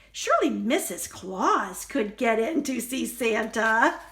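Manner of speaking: plain reading voice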